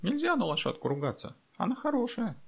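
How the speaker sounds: put-on very high-pitched child's voice; tiny high voice, mildly indignant; indignant little girl. A narrator's reading. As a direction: protest like an offended small child. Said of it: "возмутилась Настёна".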